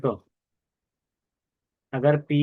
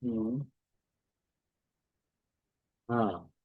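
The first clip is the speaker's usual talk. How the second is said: ordinary talk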